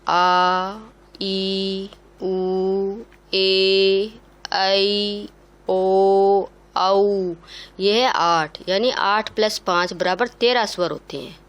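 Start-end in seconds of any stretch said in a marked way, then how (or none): 0.1-7.3 each vowel held for two beats, louder; long vowels. Shown as a demonstration. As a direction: stretch every vowel out to a double length. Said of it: "दूसरा है दीर्घ स्वर". (हर स्वर लंबा, दो मात्रा तक खींचकर)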